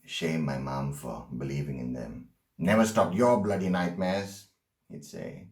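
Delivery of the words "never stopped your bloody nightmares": loud, scornful father's jibe; scoffing. Acted. Son mimics his dad shaming mom over dream catchers, scoffing. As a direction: scoff it out loudly. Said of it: "my dad scoffed at them".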